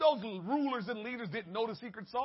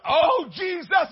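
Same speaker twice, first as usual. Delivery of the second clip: crying out Jesus; 4 semitones higher, 12 dB louder, slower than usual. Retold or imitated.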